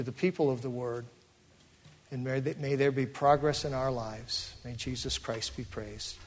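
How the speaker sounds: soft, reverent prayer voice; closing prayer. Acted. Pastor praying for his congregation, soft and reverent.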